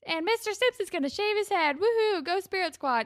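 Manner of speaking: high, cheering announcement voice; peppy school announcer. Retold or imitated. Manny on the school's morning announcements, perky and high. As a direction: read it out like a spirit squad leader. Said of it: "on the morning announcements".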